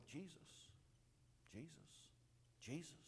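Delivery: soft, barely audible calling of Jesus; quiet murmur. Shown as a demonstration. Preacher showing a timid murmur to the congregation. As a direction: say the name softly to yourself. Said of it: "No, he was going".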